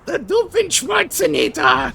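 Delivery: very high announcing yell; high villain shout. Retold or imitated. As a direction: squeal out the name like a villain.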